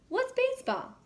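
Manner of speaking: squeaky high puzzled question; clueless question. Acted. Patrick asking SpongeBob what baseball is, high-pitched. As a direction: ask with high-pitched confusion.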